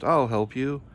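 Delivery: ordinary delivery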